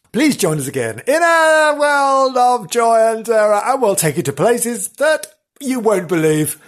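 loud, dramatic horror-host voice; vintage horror narrator. Acted. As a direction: sell the terror like an old horror show. Spooky narrator sign-off.

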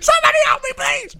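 very high mock plea for help; fluttering cries for help. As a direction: squeak out a pretend rescue call. Mock prisoner pleading.

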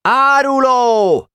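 high, drawn-out denouncing yell; shouted accusation. A narrator's reading. Kolnai denouncing the runaway before the club, shouting high. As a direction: hurl the word out as a charge. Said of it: "Kolnai ezt kiáltotta".

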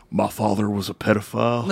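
Jake the Snake/wrestler. Retold or imitated. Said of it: "he goes".

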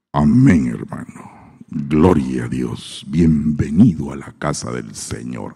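overly solemn mock deacon greeting a newcomer; put-on deep church voice. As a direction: fake a deep holy voice to sound profound. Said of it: "hay gente que imposta la voz para sentirse así más profundos".

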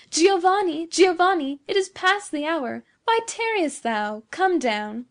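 high, loud call floating up from the garden; sweet woman's call. A narrator's reading. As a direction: call up to him in a high, rich voice. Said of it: "a rich, sweet voice came floating up".

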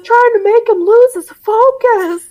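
put-on high, loud squeaky teen; squeaky adolescent whine; teen boy voice.

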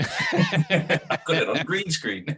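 slow, laughing through words. Real, not acted. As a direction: laugh it out, amused at himself. Laughing at own setup.

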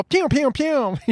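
high, punchy toy-gun pews; TikTok-style pew pew pew; pew sound effect. Retold or imitated. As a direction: fire off three cartoon pews. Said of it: "you go".

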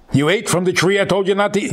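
angry accusation. Shown as a demonstration. Wrong reading: God angrily accusing Adam. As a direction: snap it out like a scolding. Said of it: "Why are you making it an angry statement?".

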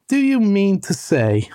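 slow, high-pitched incredulity; excited disbelief, drawn out high. A narrator's reading. Rat astonished at mole, excited and drawn out. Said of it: "cried the excited rat".

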